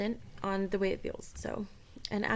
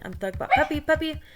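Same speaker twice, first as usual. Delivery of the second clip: excited; 7 semitones higher, 9 dB louder than usual. Real, not acted.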